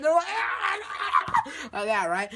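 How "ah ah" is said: high, ragged cries; mock pained screams. Astronauts getting hit by the wave, mock screams. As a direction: yelp as if battered, played up.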